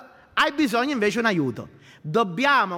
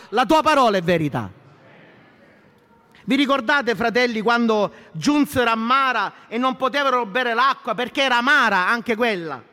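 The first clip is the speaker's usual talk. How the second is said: louder, higher rousing sermon voice; full-force preaching; 5 semitones higher, 3 dB louder than usual. Acted. Pastor rousing the church with God's word, full-force preaching. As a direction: preach it out to the congregation.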